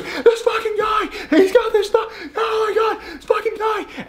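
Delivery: very high, hammed-up panicked yelling; mock hysterical screaming. Patient spotting her stalker's envelope, high mock screaming. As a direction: squeal it like a woman losing it. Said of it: "He goes".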